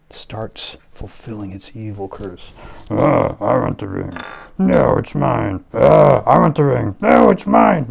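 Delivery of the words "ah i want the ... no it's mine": loud back-and-forth grabbing quarrel; squabbling giants. Acted. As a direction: fight over it like greedy kids. Giants fighting over ring.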